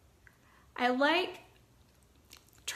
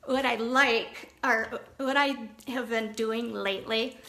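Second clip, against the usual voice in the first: normal talking voice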